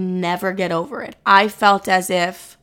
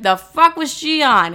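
baffled, drawn-out and higher pitched; incredulous reaction; 6 semitones higher, slower than usual. Retold or imitated. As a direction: stretch it out in mock bewilderment. Mocks own old episodes.

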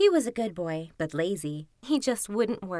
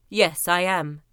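put-on lower-pitched boy character; plain-spoken Kuzma; 5 semitones lower, 4 dB louder, slower than usual. A narrator's reading. Kuzma answering the fox, low and simple.

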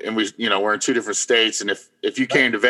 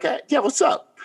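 much higher, oblivious and breezy; innocent reply. Retold or imitated. Ben answering the older lady innocently, high breezy. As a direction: answer like nothing happened. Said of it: "And I'm like".

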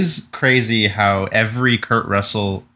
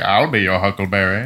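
imitating a movie gunslinger's Southern aristocrat accent; playful take on the cowboy accent; 3 semitones lower than usual. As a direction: quote the famous line in an old Western accent. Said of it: "He's like".

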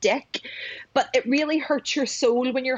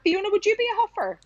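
bright, fast teasing ask; playful high question; 11 semitones higher, faster than usual. Real, not acted.

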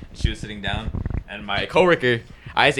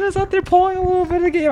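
teasing. Pretend. Teasing about King flirting.

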